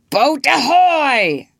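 high, loud, drawn-out call; Hook's hail. A narrator's reading. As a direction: bellow for the boat across water. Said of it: "again came the voice".

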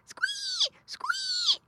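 very high pig squeals; squealing piglet. A narrator's reading. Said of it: "cried Squinty".

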